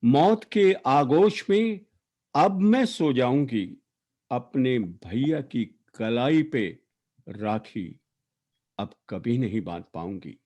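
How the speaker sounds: emphatic, declaimed verse, line endings falling away; poetry recitation. Acted. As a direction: recite an elegy with weighted words and long pauses. Recites own poem for late sister.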